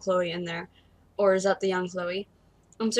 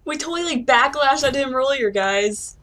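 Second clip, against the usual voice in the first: higher, loud burst of dismay; alarmed realisation; 6 semitones higher, 7 dB louder than usual. Real, not acted. Player realising they crossed Chloe's stepdad earlier, loudly alarmed. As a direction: blurt it out loudly to your viewers.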